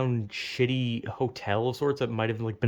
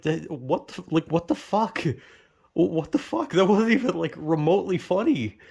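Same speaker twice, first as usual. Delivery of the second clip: higher-pitched, incredulous protest; retold disbelief; 6 semitones higher than usual. Dreamer reacts to a disturbing dream, incredulous protest. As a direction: stumble into it, appalled and baffled. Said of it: "I was like".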